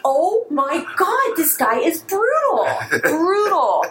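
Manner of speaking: high, drawn-out mock dismay; retold appalled disbelief. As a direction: stretch each word in exaggerated horror. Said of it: "I was like".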